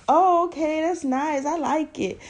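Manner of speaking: a hint of a boyfriend's polite lie; imitating boyfriend. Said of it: "Lying again".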